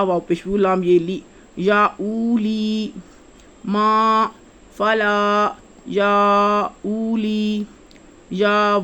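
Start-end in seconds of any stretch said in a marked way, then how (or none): 1.6-2.9 یا اولی said slowly, the آ after یا pulled long; slow Quran-teacher recitation of یا اولی; drawn-out madd. Shown as a demonstration. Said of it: "یہ کھینچ کے لمبا آنا ہے مدہ". 5.9-7.6 یا اولی said very slowly, the یا stretched far out; very slow, drawn-out recitation of یا اولی; long madd. Shown as a demonstration. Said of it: "ایسے پڑھا جانا چاہیے".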